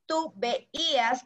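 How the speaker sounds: spelled out slowly for copying; slow dictation. Shown as a demonstration. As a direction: stretch it out for the class to write. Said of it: "con tilde en la i". (dictado lento y marcado)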